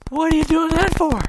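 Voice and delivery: high-pitched incredulous question; mock bafflement. Husband puzzled by wife's unusual ways, high mock incredulity. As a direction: play up the exasperated disbelief.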